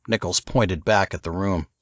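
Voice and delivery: usual speaking voice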